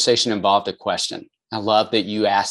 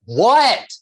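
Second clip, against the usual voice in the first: loud, high-pitched shout imitating a teenage boy; mock teen bellow, much too loud; brusque teen yell; 12 semitones higher, 5 dB louder than usual. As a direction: shout back like an oblivious teenager. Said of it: "the delivery was so off".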